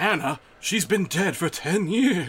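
spooky voice. Retold or imitated. Creepy line of ghost story.

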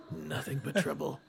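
inner monologue. A narrator's reading. Chris repeating her thought to herself, hushed. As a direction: say it softly to yourself. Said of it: "It's her inner monologue".

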